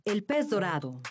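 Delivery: ordinary talk, nothing marked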